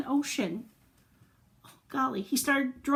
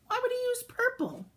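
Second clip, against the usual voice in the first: much higher, playfully baffled question; mock puzzled; 13 semitones higher than usual. Asking children why purple apples, playful mock surprise. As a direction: act amazed for the kids. Questioning the kids about crayon.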